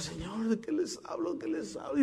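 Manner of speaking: softly pleading with God, worried about the sermon; nervous prayer. Acted. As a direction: pray quietly as a nervous first-time preacher. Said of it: "Y estaba nervioso".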